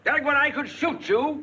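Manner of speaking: high, angry threat; exasperated outburst. Acted. Boss fed up with his forgetful employee, exasperated outburst. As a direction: explode at a hopeless employee.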